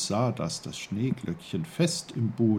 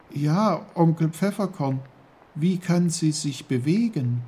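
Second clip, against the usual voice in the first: put-on high, loud boy's question; raised, keen character voice; Peter, curious; 8 semitones higher, 6 dB louder than usual. A narrator's reading. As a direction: ask eagerly in a young voice. Peter asks eagerly.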